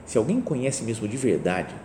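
ordinary delivery

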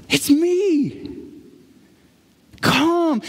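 high, drawn-out invitation; joyful call. Retold or imitated. Jesus inviting the longing crowd, joyful call. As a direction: call them in, pitched high. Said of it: "in the midst of joy".

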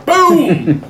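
a high, sudden mock boom; imitated gunshot. Acting out the shotgun scene for friends, mock boom. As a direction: shout a cartoon gunshot.

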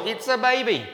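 high, loud, delighted cry; doting baby gush. Retold or imitated. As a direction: marvel at someone else's newborn. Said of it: "you find yourself saying the dumbest things".

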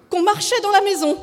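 high, loud insistence; agitated, high-pitched protest; anxious wife. A narrator's reading. Anxious wife probing husband.

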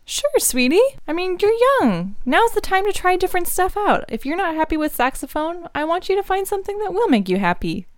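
high motherly voice; mom voice. Acted. Mom voiced by same actor.

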